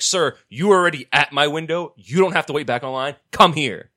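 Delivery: loud, pushy calling out across the counter; retold yelling. Postal clerk calling a customer back to her window, loud and bossy. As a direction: yell him back to the window. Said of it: "she's yelling at him".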